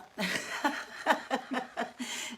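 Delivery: loud, high peals of laughter; burst of laughter. Real, not acted. Host as someone walks in the door, laughing out loud.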